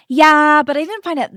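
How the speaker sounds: loud high "yeah", then a shrugging objection; mock an unimpressed schoolgirl; imitated dismissive girl.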